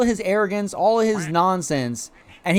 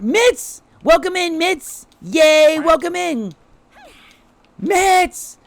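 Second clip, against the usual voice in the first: much higher, louder, gleeful welcome; excited name call; 14 semitones higher, 5 dB louder, much slower than usual. Real, not acted. Welcoming Mitz to the stream, gleefully calling his name. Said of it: "I just wanna scream it".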